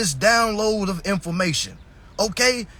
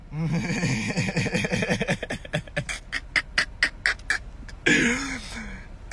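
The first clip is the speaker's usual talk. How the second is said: long, low laugh trailing off; drawn-out laugh; 4 semitones lower, 5 dB quieter than usual. Real, not acted. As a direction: laugh on and on at the absurdity. Laughs at the quoted answer.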